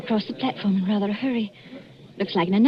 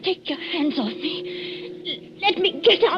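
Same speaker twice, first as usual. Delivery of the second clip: frightened plea; 7 semitones higher, slower than usual. Acted. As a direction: beg him, rising into fear. Wife struggles against husband.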